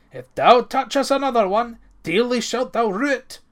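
loud, high-pitched angry threat; enraged. A narrator's reading. Ranald threatens the bannock thief, enraged. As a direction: cry it out as a furious warning. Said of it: "Ranald cried in rage".

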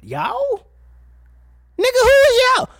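high, loud yell of disbelief; exasperated shout. Real, not acted. Exasperated mock question.

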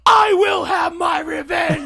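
high, slow shrieking cry of revenge; seething shrieking spirit. Pretend. Mock Kratos vengeful shriek.

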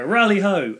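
high, loud hailing shout; dwarves' greeting call. Acted. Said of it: "the dwarves in there will go".

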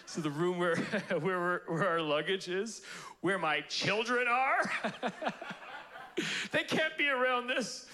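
high, laughing through the words; can't stop laughing while telling it; breaking up with laughter. Real, not acted. Laughing retelling of complaint.

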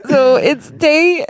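high, drawn-out fake weepy voice; hammed-up misery, stretching the words slowly; mock lonely podcaster. Pretending to podcast alone while partner's away, mock tearful. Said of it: "You should do a podcast by yourself".

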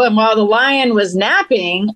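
ordinary talk

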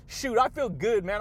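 ordinary delivery

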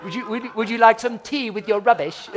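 high, hammed-up posh British voice; mock a Brit offering tea. Americans mocking a British speaker, posh accent. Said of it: "We go, "Oh, are you British?".

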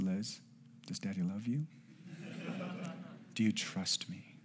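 soft, measured questions with long pauses; gentle fatherly questioning. Retold or imitated. Dad asking daughter to trust him, soft and deliberate. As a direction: ask softly, like a patient dad leading up to something.

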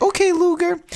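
put-on very high, loud kid voice; cheeky boy. Boy hero answers villain with wrong name, high and squeaky.

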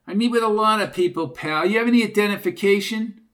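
Junior, brash. Acted. Junior demanding identification from two strangers, brash and fast.

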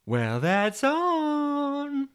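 very high, extremely drawn-out voice; Jennifer. Acted. TV Jennifer tempting Toby to watch, high coaxing voice. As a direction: stretch every word out, high and tempting. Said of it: "she'd say".

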